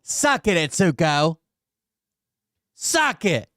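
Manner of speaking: high-pitched crow, falling away; high, triumphant jeer; gloating taunt. Real, not acted. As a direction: rub it in, pitched well up. Crowing taunt to Atsuko.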